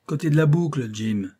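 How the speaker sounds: captain directing a flogging; commanding. A narrator's reading.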